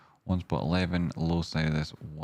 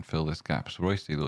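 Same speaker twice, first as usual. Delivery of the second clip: ordinary talk, nothing marked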